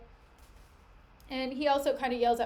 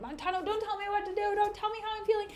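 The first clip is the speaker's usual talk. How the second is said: put-on high, fast drunken protest; drunk Cassio; 9 semitones higher, faster than usual. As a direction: whine back at him like a drunk. Drunk Cassio snapping back.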